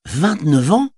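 "Vingt-neuf ans?" rising high on "ans" in disbelief; a boy amazed at the age, rising question; amazed whistle question. Acted. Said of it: "dit le garçon avec un sifflement".

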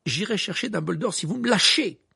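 loud, pressing protest; exasperated exclamation. A narrator's reading. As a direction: burst out loudly, desperate to get free. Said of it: "s'exclama Harry".

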